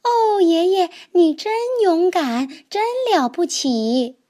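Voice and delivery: high, loud admiring little toad; excited child cry. Acted. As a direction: cry out in awe of grandpa. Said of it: "他叫道". (小蛤蟆高声惊叹爷爷)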